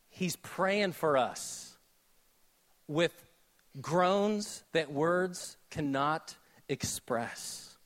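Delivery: slow reverent hush. Acted. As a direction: let each word sink in.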